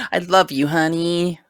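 high, put-on lovey-dovey voice; mock sweet spouse. Joking husband wiping bug on wife, mock sweet cooing. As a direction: coo it with fake affection.

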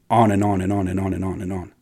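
monotone chain of repeats, rattled off; nonstop. Retold or imitated. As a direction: run it on without a break. Mimics the nonstop ranting.

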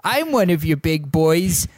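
Adam Sandler Hubie voice. Acted. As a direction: squeeze the voice up like a timid Halloween oddball. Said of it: "this is how we're gonna talk".

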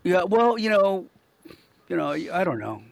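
normal talking voice

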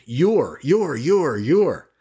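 "your" repeated, each one punched and falling; stressing your. Shown as a demonstration. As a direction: hammer the word "your" again and again. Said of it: "stress that word and put it in bold caps".

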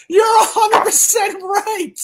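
high squeaky giggling through the words; real laughter breaking the line; laughing. Real, not acted. Admitting Rachel is right, high-pitched laughing. Laughing at a viewer's correct guess.

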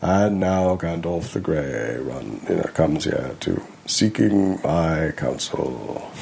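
slow, drawn-out villain voice; Saruman. Acted. Practicing his character voice on a Tolkien line, slow wizard voice. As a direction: intone it slowly, like a wizard in his tower. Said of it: "had to practice with, like, Lord of the Rings".

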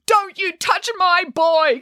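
high, slow protective shout; mother crying out to protect her son; fierce cry. A narrator's reading. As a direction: defend her boy. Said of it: "cried Geppetto".

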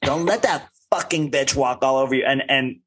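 normal talking voice

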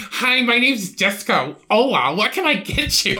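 high, slow sing-song waitress voice; mock waitress greeting. Mocking a waitress greeting customers, slow and high. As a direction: put on a chirpy server voice.

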